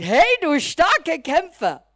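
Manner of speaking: loud, booming call of "Hey, du starke Kämpfer"; mock heroic greeting. Pastor acting the stranger who flatters Gideon, loud and grand. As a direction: hail him like a mighty warrior, overdone.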